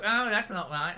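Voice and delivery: a hint of a whiny moan; mock moan. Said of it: "moan and go".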